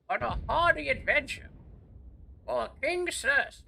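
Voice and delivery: high, theatrical fake British voice, swooping on key words; mock British old man. Gamer playing a tavern order for King's Ale, mock British old-man voice. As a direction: play a hammy medieval British old wizard. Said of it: "with my British accent, which is not really British".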